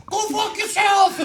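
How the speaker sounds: slow, high, loud imitation of a shouted insult; mock taunting yell. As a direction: holler it high and drawn out, for laughs. Retold yell after bottle throw.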